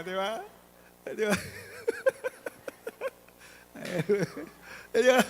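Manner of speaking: normal talking voice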